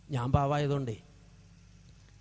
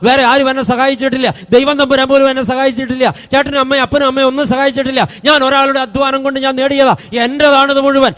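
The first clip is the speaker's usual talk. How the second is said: higher, louder bragging; boasting man claiming all his wealth, louder; boastful unblessed man; 6 semitones higher, 7 dB louder than usual. Retold or imitated. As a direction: brag that it was all your own sweat. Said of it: "ഇത് ആരുടെ ഡയലോഗാ?".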